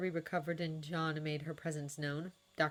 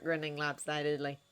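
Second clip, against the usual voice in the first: plain reading voice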